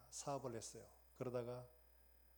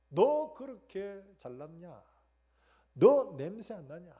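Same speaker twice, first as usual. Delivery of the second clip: loud, slow, stern rebuke; rebuking God's voice; 5 semitones higher, 16 dB louder, much slower than usual. Acted. Preacher voicing God loudly rebuking Jonah, slow and stern. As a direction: drag each word out like a loud reproach. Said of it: "주님 묻고 있는 거죠".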